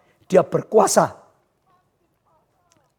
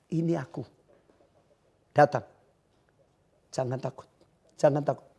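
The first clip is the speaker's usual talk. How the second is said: soft, low and hushed, fading to a whisper; calm reassurance; 6 semitones lower, 10 dB quieter than usual. Acted. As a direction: soothe the frightened ones gently. Jesus speaks after bad news. (suara lembut menenangkan)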